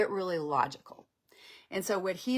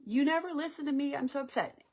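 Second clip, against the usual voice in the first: high, fast, mildly tearful complaint; a touch of tears in a hurt girlfriend's plea; upset girlfriend, lightly acted crying; mock crying; 8 semitones higher, faster than usual. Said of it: "So she starts to cry".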